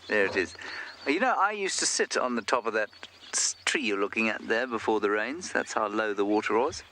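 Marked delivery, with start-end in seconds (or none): none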